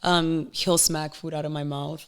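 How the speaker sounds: normal talking voice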